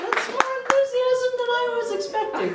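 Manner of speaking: high surprised delight. Real, not acted. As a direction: caught off guard by cheers. Surprised at crowd cheer.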